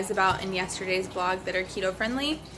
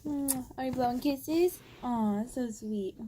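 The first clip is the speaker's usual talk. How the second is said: sweet nothings; 4 semitones higher, 5 dB quieter, slower than usual. Real, not acted. Mom to baby blowing kisses.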